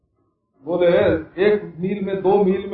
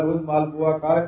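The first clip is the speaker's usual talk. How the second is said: usual speaking voice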